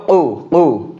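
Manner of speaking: কু with a small push from the back; কু said with a firm back push. Shown as a demonstration. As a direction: punch কু from the throat. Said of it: "একটু ধাক্কা দিয়ে উচ্চারণ হবে কু".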